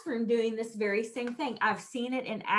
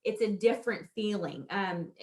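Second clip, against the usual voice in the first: ordinary talk, nothing marked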